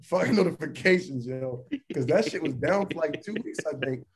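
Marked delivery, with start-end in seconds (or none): 0.0-1.7 Jeff Bezos. Retold or imitated. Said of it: "Jeff Bezos was like".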